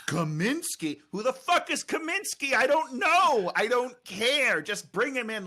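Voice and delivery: high, loud incredulous outburst; comic indignant yelling; exasperated rant. Acted. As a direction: rant at full tilt, voice shooting up. Mock rant about the movie.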